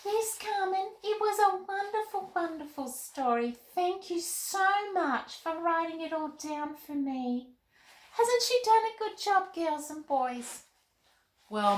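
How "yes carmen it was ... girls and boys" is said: put-on high, bright little girl puppet voice; Molly the puppet chatting with Carmen, in a high girl voice.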